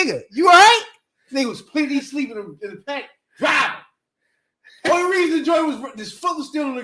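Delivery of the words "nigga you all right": loud high call through the glass; loud high check-in call. Retold or imitated. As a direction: call out to wake him. Calling to sleeping driver.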